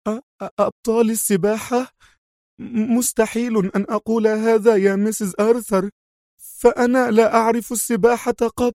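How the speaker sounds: high, stammering disbelief; stunned nanny. Acted. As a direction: repeat it in shock. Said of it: "في دهشة بالغة".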